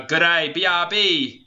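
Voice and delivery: put-on slow, high Australian accent; big drawn-out Aussie greeting; Steve Irwin. Co-host performs Steve Irwin on request, classic Australian accent. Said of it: "make him say it in his classic accent".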